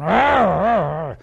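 mock irked grumble, rising then falling; imitated grumbling. Irked Pharisees always around, grumbling in mock annoyance. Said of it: "And they're going".

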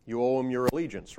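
normal talking voice

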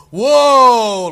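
loud, high startled whoa; mock startled shout from the background; imitated yell. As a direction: shout it like a bystander caught off guard. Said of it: "this voice in the background go".

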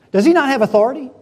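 loud, high, rapid demand; browbeating question. Acted. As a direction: press him hard with the question. Jezebel pressing Naboth, acted.

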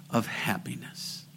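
very soft, whispered close; hushed whisper. Real, not acted. Preacher leaving the message with the congregation, whispered. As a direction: drop to a whisper for the punchline.

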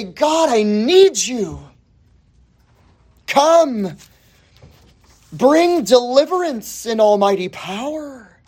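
slow, high imploring prayer; prayerful plea. Acted. Believer begging God for deliverance, pleading. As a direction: cry to God, drawing out each word. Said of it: "where you say".

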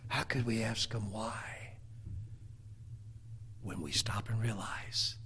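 very low, soft, sinking into whisper; long pauses between near-whispered phrases; hushed, reverent preaching. Acted. Preacher telling why Jesus paid the price, hushed.

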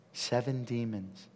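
ordinary talk

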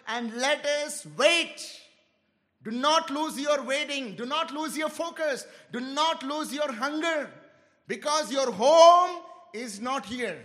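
slow, insistent sermon exhortation building to a loud close; fervent preaching. Acted. Preacher urging the church not to lose hope, fervent. Urging the congregation to keep waiting.

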